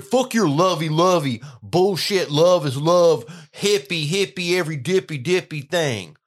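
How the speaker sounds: loud, high, drawn-out scornful rant; mocking angry rant. Host mimicking the hateful people of today, mocking angry rant. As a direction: imitate a bitter hater, loud and high.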